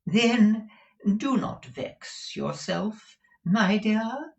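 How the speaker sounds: high, slow, doting; calm your wife like a fop; high pampering fop; soothing dandy. A narrator's reading. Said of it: "said Mister Mantalini".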